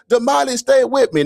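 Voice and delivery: high frantic mock plea; imitated screaming. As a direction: plead at the top of the voice, mimicking a friend. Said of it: "Then he starts screaming this".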